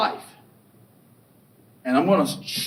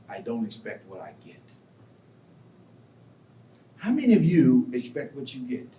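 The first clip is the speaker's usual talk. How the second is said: normal talking voice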